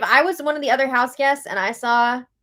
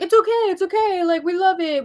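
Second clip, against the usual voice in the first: higher, fast mock-gushing houseguests; 8 semitones higher, 4 dB louder than usual. Houseguests cheering on the couple's showmance, mock gushing. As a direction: gush like a fan, hammed-up.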